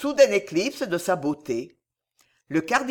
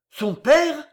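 much higher, louder gasped question; gasping shock; 9 semitones higher, 7 dB louder than usual. A narrator's reading. Charles gasping in horror at the cardinal. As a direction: gasp it in horror. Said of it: "haleta Charles".

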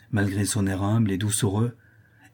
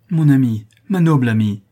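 louder, a cry full of emotion; moved exclamation; 3 semitones higher, 7 dB louder than usual. Acted. Father crying out his devotion to Madame Roland, moved and loud. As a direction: burst out, deeply moved. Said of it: "s'écria mon père d'une voix émue".

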